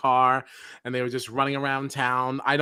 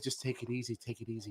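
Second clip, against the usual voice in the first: soft mock soothing; 3 semitones lower, 14 dB quieter, faster than usual. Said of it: "she's like".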